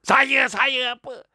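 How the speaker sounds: loud, high, drawn-out mocking demand; hammed-up exasperated husband shouting; impatient husband. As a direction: explode at her stalling. Husband impatiently cuts off stammering wife.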